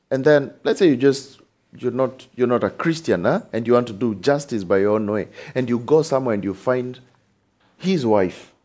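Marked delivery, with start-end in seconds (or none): none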